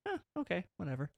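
soft, unbothered brush-off; acted indifference, high huh then dropping; dismissive shrug. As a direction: shrug it off quietly. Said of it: "I'm more so just like".

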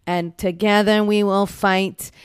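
slow, rousing union speech in her voice; rally the members like a pledge, slowly; Fran Drescher impression. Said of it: "she really goes hard on".

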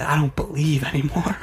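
half-whispered comic despair; thin, airy mock-desperate cry; mock losing faith. Swimmer twenty yards out to his friends, mock despair.

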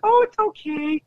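high, airy mock-sweet voice; mock lenient parent. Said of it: "they're like".

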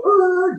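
high mock growl of digging in; cartoon skid growl, high and loud; high braking growl. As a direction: growl like Fred Flintstone dragging his feet to stop. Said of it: "like Fred Flintstone's feet in the dirt".

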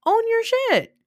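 very high, exasperated cry; escalated scolding. Acted. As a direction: pitch it way up, then drop sharply at the end. Repeats the scolding, more heatedly.

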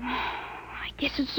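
sigh, then whisper it slowly; relieved whisper. Acted. Boy to his father after the fanatic leaves, slow relieved whisper. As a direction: breathe out the tension, slow and unvoiced.

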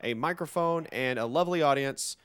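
grand show-opening welcome; announcer intro. Acted. Host welcoming podcast listeners, grand announcer style. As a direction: announce the episode like a showman.

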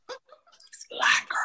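breathy whispered aside, slow. Real, not acted. Guest confides to the host about a bad date, in a slow whisper.

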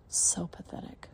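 breathy taunting whisper. Acted. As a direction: whisper it with contempt. Mistress taunting the listener.

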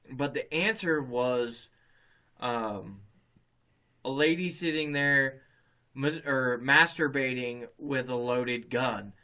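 ordinary talk, nothing marked

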